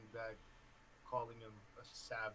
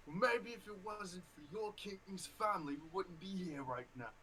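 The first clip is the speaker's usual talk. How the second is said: angry orc retort; 8 semitones higher, 5 dB louder than usual. Acted. GM voices the orc yelling back.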